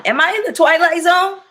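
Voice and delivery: high incredulous question, stress on "the". Real, not acted. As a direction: ask it like the world stopped making sense. Repeats the question in disbelief.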